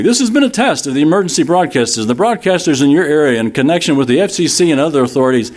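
imitating a cheery announcer rattling off boilerplate; happy announcer. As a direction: race brightly through the official notice. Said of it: "And he's all happy".

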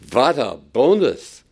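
put-on excited Yiddish-tinged accent, v for w; a gleeful worker bragging in a Yiddish accent; mock Yiddish-flavored worker. Worker bursting in telling Mama, mock Yiddish accent. Nine o'clock worker exults.

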